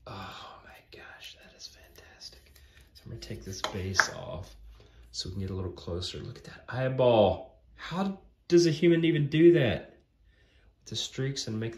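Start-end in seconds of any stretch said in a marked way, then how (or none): 0.1-3.1 awed whisper. Real, not acted. Awed at the Beyonder figure. 7.8-9.9 loud, high-pitched disbelief; astonished. Real, not acted. Marvelling at painted eyeball.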